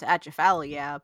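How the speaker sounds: Pittsburgh Yinzer Atchafalaya, ending "-FAL-ee-ya" with a flat a; Yinzer accent. Shown as a demonstration. Contestant joking about making the word Yinzer, Pittsburgh accent. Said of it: "if I wanna make it Yinzer".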